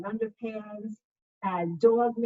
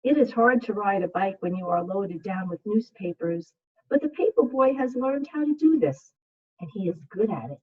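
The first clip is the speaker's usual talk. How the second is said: put-on reading to children; 7 dB louder than usual. Second grade teacher reading a picture book to her class, soothing.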